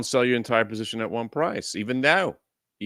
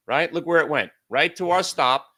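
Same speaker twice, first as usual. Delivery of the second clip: ordinary talk, nothing marked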